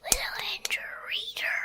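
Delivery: breathy whispered sting. Acted. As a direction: whisper the name like a secret.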